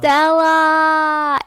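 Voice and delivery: loud, high, drawn-out holler; Brando-style movie shout, stretched out; imitated yell. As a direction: wail the name out long, imitating a movie yell. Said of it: "and yelling".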